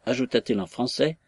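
ordinary delivery, nothing marked

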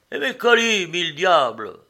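high, loud indignant outburst; exclaimed protest. A narrator's reading. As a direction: cry out after your forgotten luggage. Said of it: "en s'écriant".

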